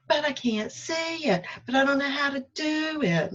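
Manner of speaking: high, pleading objection to her inner voice; whiny protest. Retold or imitated. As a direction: whine back that you can't see the way. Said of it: "I had to argue for a minute".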